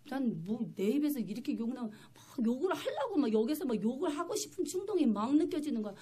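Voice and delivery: normal talking voice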